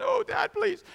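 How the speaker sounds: very high, squeaky mock pleading; whining child. Guilty child begging Dad for forgiveness, mock whine.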